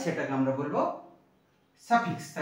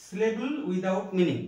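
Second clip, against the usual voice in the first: slow definition; 2 semitones higher, much slower than usual. Shown as a demonstration. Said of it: "বাংলায় ধ্বনি বলে".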